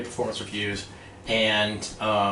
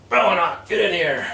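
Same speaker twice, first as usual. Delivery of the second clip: barked summons; 7 semitones higher, 4 dB louder than usual. Retold or imitated. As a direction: boss calling out, higher and louder. Boss summons him into office.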